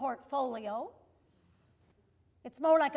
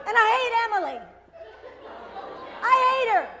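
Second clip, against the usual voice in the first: loud, higher hate outburst; angry rant; 7 semitones higher, 5 dB louder, much faster than usual. Real, not acted. Comic ranting about Emily from Bell Canada, loud. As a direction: spit out your hatred of her. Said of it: "rising rant".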